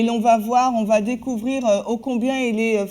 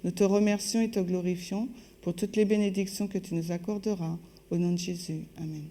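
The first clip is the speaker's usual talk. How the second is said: soft, low-key spoken prayer; 3 semitones lower, 7 dB quieter than usual. Real, not acted. Interpreter asking God to assist Alison and bless listeners, prayerful. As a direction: pray quietly and reverently, in measured phrases.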